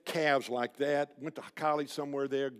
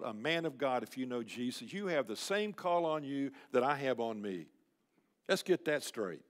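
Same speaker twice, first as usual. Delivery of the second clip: ordinary talk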